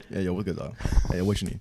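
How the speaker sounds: relaxed slangy buddy voice; casual with friends. Retold or imitated. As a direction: talk like you're greeting your boy. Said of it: "how I've talked to my friends".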